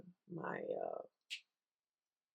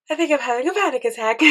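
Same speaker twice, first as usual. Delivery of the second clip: mock panic; 7 semitones higher, 9 dB louder, faster than usual. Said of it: "and I'm like".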